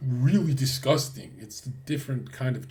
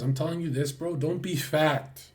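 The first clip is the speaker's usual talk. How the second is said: ordinary delivery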